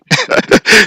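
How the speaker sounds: squeaky high laugh at his own joke; a little squeal of a laugh; high giggle. Real, not acted.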